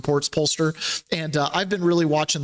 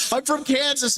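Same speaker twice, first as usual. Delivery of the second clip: higher-pitched mimicked answer; retold captive; 9 semitones higher than usual. Captive telling the questioner a home state, high-pitched mimicry.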